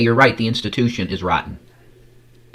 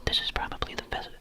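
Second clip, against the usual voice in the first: breathy unvoiced whisper; hushed intimate whisper into the mic; 6 semitones higher, 10 dB quieter than usual. Shown as a demonstration. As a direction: drop to a soft ASMR whisper. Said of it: "the best voice that I could use".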